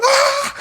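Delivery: sudden high-pitched shriek; re-enacted falling scream. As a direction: shriek as the floor drops away. Acts out scream as they drop.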